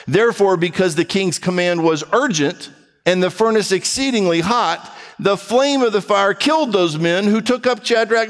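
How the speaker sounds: high, driving preacher read; fervent sermon scripture read; impassioned scripture reading. Acted. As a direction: proclaim the story with rising fervor. Preacher reading scripture at raised pitch.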